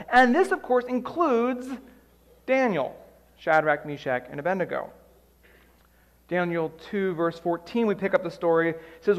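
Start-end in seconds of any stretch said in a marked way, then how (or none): none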